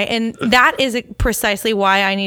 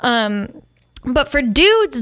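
ordinary talk

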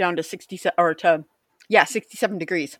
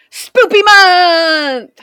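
loud, slow, drawn-out announcement; excited shout; 9 semitones higher, 10 dB louder, much slower than usual. Real, not acted. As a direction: call out the holiday with glee. Announcing spooky month.

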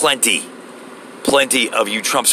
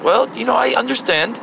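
higher, put-on voice for the Indian store lady; lightly mocking manager voice; imitating the store lady; 7 semitones higher than usual. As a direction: play the manager, pitched up. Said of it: "the little Indian lady's like".

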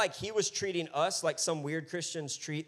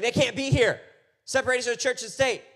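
higher and louder, insisting; mock protester shout; 8 semitones higher, 9 dB louder than usual. Protester telling the pastor to leave, loud and high. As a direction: shout them off the campus.

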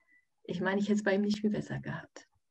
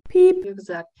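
ordinary talk

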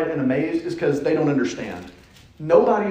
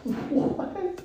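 high, soft squeak of fake shock; mock surprise; 5 dB quieter than usual. Preacher feigning shock at his own joke, high and soft. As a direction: play dumb, as if caught out.

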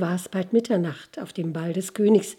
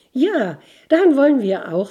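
higher, louder, eager reply; eager answer; 6 semitones higher, 6 dB louder than usual. Acted. Ragged girl eagerly telling the rider where they go, higher and louder. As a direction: jump in gladly with the answer.

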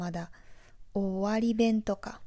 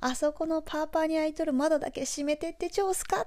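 high Nagoya dialect request, rising at the end; native Nagoya dialect; 7 semitones higher than usual. Shown as a demonstration. Native speaker's example of asking someone to shut a window, Nagoya dialect. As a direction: ask it in homegrown Nagoya dialect, pitched up. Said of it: "ネイティブ名古屋弁で例文を作るとしたら".

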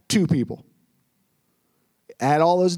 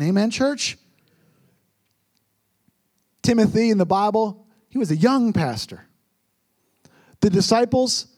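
usual speaking voice

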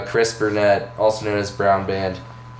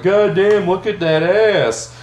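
high, slow leering catcall; lightly hammed-up leering freshman; cocky catcall; 8 semitones higher, slower than usual. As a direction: hoot it at her like a dumb freshman. Retold catcall to drum major.

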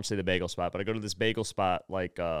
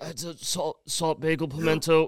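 nervous; 4 semitones higher than usual. Retold or imitated. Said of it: "always too nervous".

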